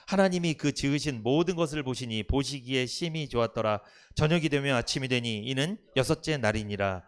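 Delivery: loud, steady group recitation of scripture; scripture reading. Real, not acted. Congregation reads Genesis aloud together in unison.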